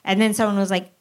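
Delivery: ordinary delivery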